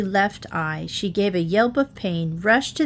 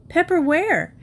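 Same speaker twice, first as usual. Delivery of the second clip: put-on little girl voice; 8 semitones higher than usual. Child asks.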